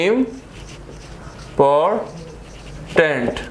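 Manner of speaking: Important very slowly as इम, पॉर, tent; syllable by syllable. Shown as a demonstration. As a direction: leave long silences between the three syllables of important. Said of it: "three syllable word".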